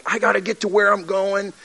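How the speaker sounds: a hint of road-rage impatience; impatient. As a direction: play the hurried driver who's fed up. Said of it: "you'll be really impatient and you're like".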